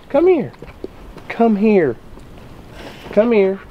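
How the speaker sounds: high beckoning, falling at the end; coaxing, high-pitched summons; high coaxing call. Real, not acted. Coaxing upset child over to him.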